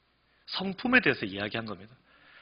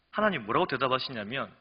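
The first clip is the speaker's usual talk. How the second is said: usual speaking voice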